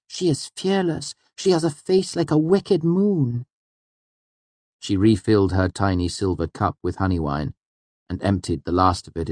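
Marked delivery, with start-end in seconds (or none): 0.1-3.5 put-on high, awed girl character; high-pitched storybook marvelling; wide-eyed Auri. A narrator's reading. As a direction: describe it with wonder in a high girlish voice. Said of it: "her eyes wide".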